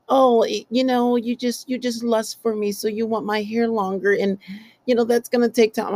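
high-pitched mimicry of a woman; high, put-on voice of an annoyed girlfriend; imitated complaining woman.

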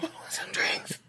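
whispered, out of breath; breathless whisper. Acted. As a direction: gasp it out in a whisper. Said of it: "the guy who's running out of breath".

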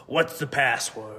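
slow, gruff doorman voice. Acted. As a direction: growl it through the door slit, slowly. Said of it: "You hear a gruff voice".

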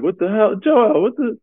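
high, incredulous laughing disbelief; mock disbelief. Laughing at his teammate's crying face, high incredulous. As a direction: squeal it out in amused shock.

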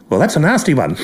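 dry, mild commentary; Norman May impression. Norman May reacting to a batsman hit, dry. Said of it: "Norman May goes".

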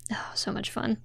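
breathy whispered aside; whispering. Real, not acted. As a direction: whisper it to yourself. Aside after plotting the episode.

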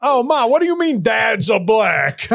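high, whiny mock protest to Ma; Jersey kid. Comedian as a Jersey kid questioning Ma, in high mock outrage. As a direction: wail it like an aggrieved Jersey son. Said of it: "if I grew up in Jersey then".

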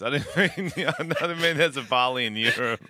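talking through laughter; giggling while explaining the slip; laughing. Real, not acted.

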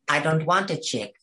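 mock transatlantic starlet voice, lightly done; old Hollywood accent. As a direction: put on a faint transatlantic accent, haughty. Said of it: "she's like".

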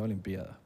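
Olimpiada quietly, stressed on PI; hiato, forma esdrújula. Retold or imitated. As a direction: quote the rule softly, o-lim-PI-a-da for Olimpiada. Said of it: "Se dice, pronuncia Olimpiada". (Olimpiada esdrújula y bajito, acento en PI)

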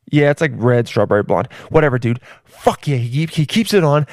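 ordinary talk, nothing marked

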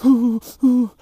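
high, loud mock sobs; imitated sobbing. Dad imitating his crying daughter, high mock sobs. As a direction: act out her little sobs. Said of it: "she was crying".

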